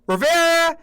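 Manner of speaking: high, bright-pitched name; mock colleague. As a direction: say the dog's name prim and high. Colleague names dog.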